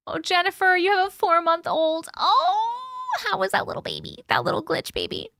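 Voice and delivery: put-on high cooing baby talk. Streamer coos at a viewer's baby in baby talk. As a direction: coo like to an infant.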